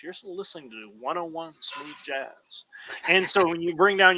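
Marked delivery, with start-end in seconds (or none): 0.0-2.6 soft, hushed radio DJ impression; late night DJ voice. Late night DJ to smooth jazz listeners, soft calming radio voice. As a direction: croon it softly like a smooth jazz host. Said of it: "the late night D J voice".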